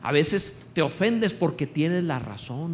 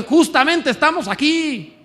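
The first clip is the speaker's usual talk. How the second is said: a hint of the thief, higher, loud and slow; thief on the cross; 11 semitones higher, 7 dB louder than usual. Preacher voicing the thief beside Jesus, higher and slow. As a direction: lightly voice the resigned thief. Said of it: "Él dijo".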